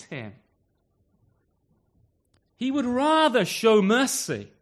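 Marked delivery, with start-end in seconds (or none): 2.6-4.5 fervent preaching. Acted.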